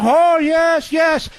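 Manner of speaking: hammed-up delight at the ADL; mock gushing. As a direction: gush like an eager fan. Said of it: "but the A D L".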